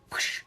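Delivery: short breathy rush of air, no voice; imitated whoosh. Meditator's head sweeping up, imitated whoosh. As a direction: make a soft whooshing noise. Imitates being swept upward.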